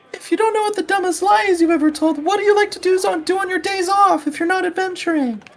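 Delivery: very high and fast questioner. Acted. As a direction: rattle it off high and quick. GM voices a crowd member.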